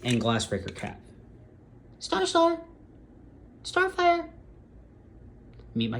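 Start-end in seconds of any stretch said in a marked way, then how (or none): 2.0-4.3 very high, thin falsetto pet call; high-pitched kitty-calling voice; calling the cat. Real, not acted. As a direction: coo the cat's name way up high.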